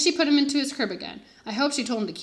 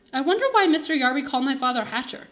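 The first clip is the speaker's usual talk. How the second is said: usual speaking voice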